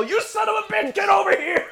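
high, shouting comic threat; angry bartender yelling at an alien; Moe's angry yell. Retold or imitated. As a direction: chase them down yelling. Imitating Moe chasing the alien.

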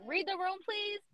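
small, high-pitched exasperated plea; pleading aside. Acted. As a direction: READ THE ROOM high and soft. Repeats plea more softly.

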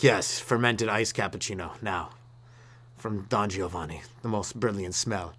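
low, slow, soft ad read; mock fragrance ad. Comedian pretending to advertise a new deodorant scent, slow low voice. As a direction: purr it like a cologne commercial.

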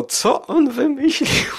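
high squeaky giggle breaking through; laughing. Real, not acted. Amused by film creature.

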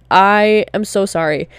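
ordinary talk, nothing marked

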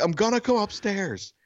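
high-pitched call up the stairs; retold calling out. Neighbor warning the silent homeowner, high retold call. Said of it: "She's not answering. I'm like".

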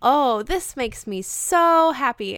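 slow, loud, happy character quote; Niku. Retold or imitated. Fan quoting Niku in the bar, drawn-out happiness. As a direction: stretch out the delight on 'so'. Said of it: "he goes".

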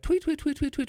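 high chirpy tweet-tweet; cartoon bird tweets. Telling his podcast host about the crash, high chirpy tweets. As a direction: chirp like cartoon birds round a dazed head. Dazed, birds circling.

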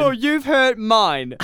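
high, loud, slow hammed-up wounded; mock hurt. Teasing the host back, hammed-up mock hurt. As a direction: overplay the offense. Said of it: "I am deeply offended".

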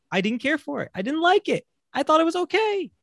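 high squeaky insistence; high insistent protest. Real, not acted. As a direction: protest each line high. Insisting on his opinion, high.

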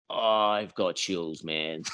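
slow, soft spooked murmur; slow and quiet, letting the creepiness land. Retold or imitated. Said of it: "he's just like".

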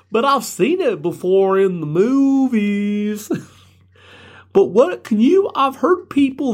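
high, slow, goofy drawn-out host voice; weird podcaster parody. Acted. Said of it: "I probably talk a weird way too".

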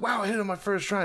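lightly voiced amazement; mildly amazed. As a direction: play pleasant surprise at your own lucky shot. Voicing Luffy's amazement at bullseye.

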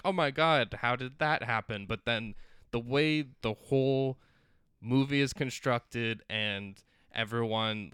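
usual speaking voice

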